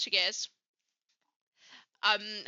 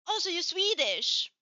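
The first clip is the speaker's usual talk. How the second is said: imitating a curious questioner, pitched up; retold question; 6 semitones higher, 4 dB louder than usual. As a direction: act out their surprised guess, higher. Quotes the person's mistaken guess.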